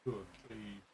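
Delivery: soft cooing to a pet. Real, not acted. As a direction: coo softly to a dog. Cooing to her dog.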